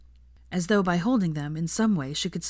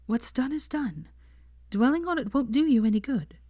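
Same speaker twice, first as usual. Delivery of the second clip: gentle comfort; 8 semitones higher than usual. A narrator's reading. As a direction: soothe him tenderly. Hermione comforts, higher voice.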